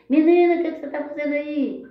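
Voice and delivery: put-on high, loud sudden call of a passerby catching a runaway girl; startled scolding call. Passerby spotting the runaway girl, calling out startled and loud. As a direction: shout out in alarm at the girl. Someone catches her escaping hospital.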